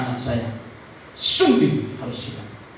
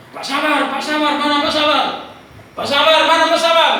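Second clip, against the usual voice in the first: high, loud searching call; 12 semitones higher, 8 dB louder than usual. Retold or imitated. Nurse searching for the father, calling out. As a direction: call across a waiting room.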